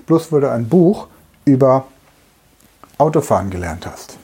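ordinary talk, nothing marked

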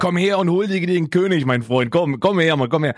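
a hint of a grand showman calling someone over; half-hearted royal beckoning call; mock king's summons. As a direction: beckon like a self-styled king, lightly hammed. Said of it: "der gesagt".

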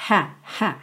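two soft "ha" puffs of air; airy H. Shown as a demonstration. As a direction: breathe out a light "ha". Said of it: "é uma letra de ar".

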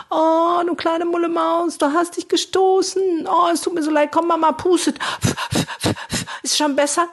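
high, cooing comfort voice; baby talk to a toddler. Retold or imitated. Carer comforting a six-year-old who bumped himself, high cooing baby talk. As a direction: fuss over a tiny bump like mum with a toddler. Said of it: "wie zu so einem Zweijährigen und sagt".

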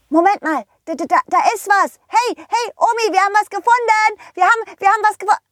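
put-on very high, stammering child; squeaky, breathless-with-excitement boy's call to grandma; excited little pirate. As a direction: rush it out like a kid making a discovery. Pirate thinks he found it.

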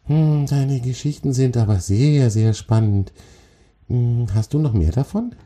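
high, appreciative spider voice; interested little spider. Acted. Spider figure speaks in character.

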